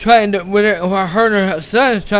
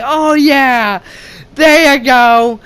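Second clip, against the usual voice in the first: slow, drawn-out, raised-voice cheer; triumphant cheer; 4 semitones higher, 3 dB louder, much slower than usual. Retold or imitated. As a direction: savour the victory as you re-enact it. Re-enacting cheering at the kill.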